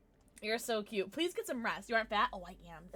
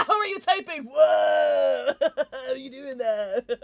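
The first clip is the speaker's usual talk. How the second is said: excited laughing shout; amazed; 9 dB louder, slower than usual. Real, not acted. Laughing shout of amazement at a viewer's typing trick. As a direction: burst out in delighted disbelief.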